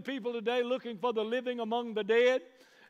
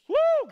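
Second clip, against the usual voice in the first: high, loud joyful whoop; 9 dB louder than usual. Real, not acted. Preacher to congregation, a loud high whoop of joy. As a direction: let out a whoop of praise.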